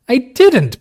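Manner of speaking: sudden loud high outburst; burst out. A narrator's reading. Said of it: "burst out Kismine".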